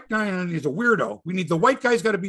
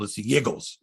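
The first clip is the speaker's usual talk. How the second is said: put-on Philly accent on Yiggles, low and clipped; 6 semitones lower, 3 dB quieter than usual. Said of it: "the Philadelphia accent".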